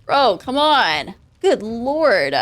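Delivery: slowed, groaning protest at bad luck; exasperated. Real, not acted. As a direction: stretch it out, fed up. Annoyed at a failed roll.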